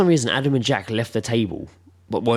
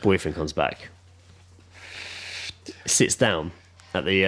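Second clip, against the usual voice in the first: ordinary delivery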